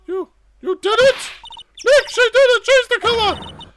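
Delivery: very high, loud shrieked accusation; outraged young sidekick. Acted. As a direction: accuse her at the top of a piping voice. Maya accuses woman of murder.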